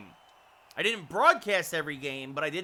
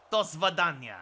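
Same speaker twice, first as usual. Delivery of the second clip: put-on Russian accent. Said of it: "Russian accent".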